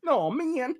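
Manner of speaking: usual speaking voice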